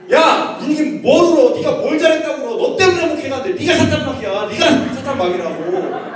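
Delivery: high, full-force acted yell; furious shouting. Husband yells at crying wife, furious shouting. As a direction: scream accusations, reenacting a marital fight. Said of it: "제가 소리쳤습니다".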